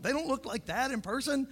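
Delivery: normal talking voice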